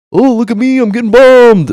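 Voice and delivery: a hammed-up cartoon voice; mock silly voice. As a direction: ham it up like a goofy victim. Mock voice joking about war.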